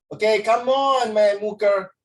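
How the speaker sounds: loud, slow cheering call to a student; playful hype-man shout; hyped-up call. Real, not acted. Teacher hyping up a student.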